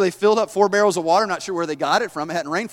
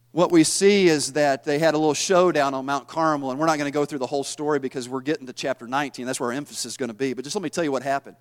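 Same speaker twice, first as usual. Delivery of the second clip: ordinary talk, nothing marked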